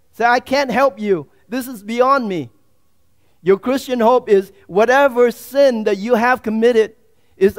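loud, fervent preaching. Acted. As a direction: preach it with full force. Preaching at full force.

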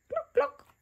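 high chicken clucks; clucking like a hen. A narrator's reading. Peppa clucking to chickens, high and playful.

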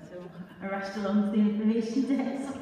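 dramatic poem with character voices; spoken word performance. Acted. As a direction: perform it to the room with rising energy.

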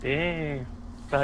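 gender swap filter. Acted. Caller voice through a voice filter.

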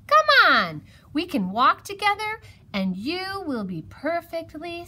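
high, loud encouragement; lively, high-pitched coaxing; coaxing hedgehog. A narrator's reading. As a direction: chivvy a nervous friend along. Hedgehog coaxes Mouse along.

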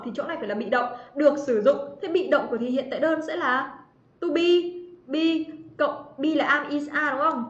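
normal talking voice

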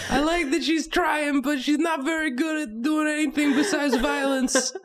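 put-on high kid voice, Brooklyn accent; squeaky little girl, Brooklyn twang; Brooklyn Anya. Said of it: "Anya from Brooklyn".

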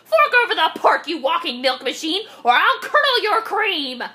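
high, threatening rant; screaming witch. A narrator's reading. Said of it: "screamed Gritch".

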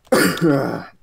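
harsh coughing fit; choking cough. Real, not acted. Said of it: "you sound like you are dying".

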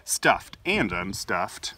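ordinary delivery

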